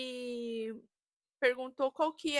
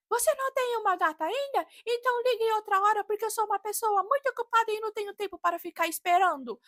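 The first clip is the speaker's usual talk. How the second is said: mock scolding clerk; 9 semitones higher, 7 dB louder, faster than usual. Rude clerk scolding husband.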